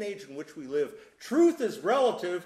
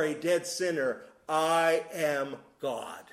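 each I am God laid down slowly and firmly, words spaced out; slow proclaimed litany; slower than usual. Acted. Preacher to congregation, voicing Jesus' claims as a slow, solemn proclamation. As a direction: proclaim it like a creed, one slow weighty line at a time.

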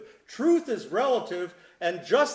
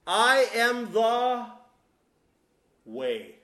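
slow solemn proclamation; 3 semitones higher, 4 dB louder, much slower than usual. Acted. Preacher voices Jesus' claim.

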